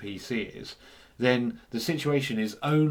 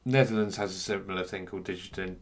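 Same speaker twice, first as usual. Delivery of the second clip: ordinary delivery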